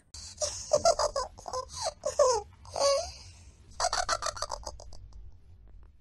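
high squealing giggles from inside the tree; mischievous elves laughing at their trick, loud and high; high giggling. A narrator's reading. Elves hiding in the tree giggling at the gnome, high and gleeful.

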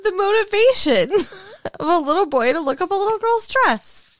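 laughing. Real, not acted. Laughing too hard to speak.

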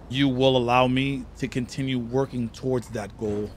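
ordinary talk, nothing marked

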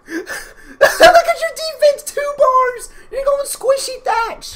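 high squeal of amused disbelief; high-pitched, cracking up at a friend; laughing mockery. Real, not acted. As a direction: tease through helpless laughter.

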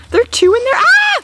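high, loud alarm; panicking gamer. Real, not acted. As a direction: panic at the double threat. Alarmed at two enemies.

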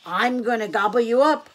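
loud, menacing troll voice; troll's threat. A narrator's reading. Troll threatens Middle Gruff, loud menace. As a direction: threaten loudly as the hungry troll.

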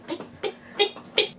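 high unvoiced chick peeps; baby chick impression. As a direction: peep like a tiny chick. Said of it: "That's my baby chick impression".

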